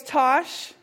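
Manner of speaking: teacher greeting a student brightly; cheery teacher. Retold or imitated. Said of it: "He goes".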